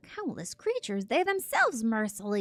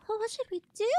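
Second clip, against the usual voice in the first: high tiny creature voice; squeaky minion voice; 13 semitones higher than usual. Acted. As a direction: voice a little minion. Voicing small minion.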